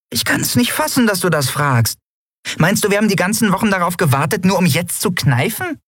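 very high, squeaky outraged voice; tiny creature in disbelief; indignant little companion. Acted. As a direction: protest in a rapid, high-pitched rush. Indignant small crew member protests.

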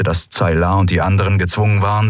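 ordinary delivery

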